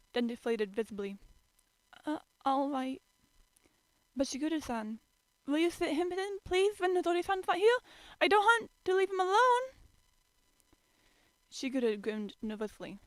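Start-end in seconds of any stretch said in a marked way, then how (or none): 2.1-9.7 put-on high, louder pleading girl voice. A narrator's reading. Toru begging Shigure to watch Yuki, high pleading. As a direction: beg him sweetly.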